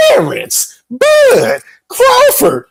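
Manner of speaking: very high, hugely stretched name call; ring announcer. Pretend. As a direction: draw out each name like a fight introduction. Hypes boxer's name like ring announcer.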